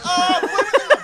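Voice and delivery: very high, loud panicked outburst; hammed-up panic, blurted at the top; mock panic. Flustered diner put on the spot, mock panicked yelp. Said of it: "I panicked".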